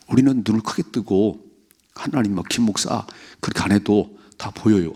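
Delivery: usual speaking voice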